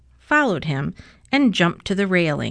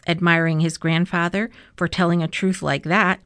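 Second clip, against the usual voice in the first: ordinary delivery, nothing marked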